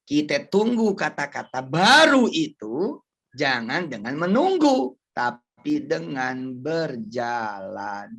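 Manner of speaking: slow, loud, lingering on each word; preacher driving home the moral slowly; slow emphatic line. Shown as a demonstration. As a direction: spell out the lesson deliberately.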